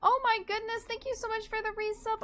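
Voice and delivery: high, bubbly streamer greeting; cute anime streamer. Acted. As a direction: squeal a welcome to the raiders. Raid welcome in streamer voice.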